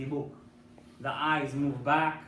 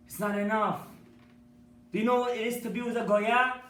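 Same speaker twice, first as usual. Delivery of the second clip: louder, higher second time, stern; insistent repeat; 7 semitones higher, 6 dB louder than usual. Acted. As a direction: press the point harder. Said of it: "He says".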